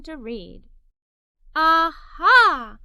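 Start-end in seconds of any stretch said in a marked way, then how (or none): 1.5-2.8 loud delighted aha; eureka shout. A narrator's reading. Girl hitting on an idea, loud happy aha. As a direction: burst out with the idea.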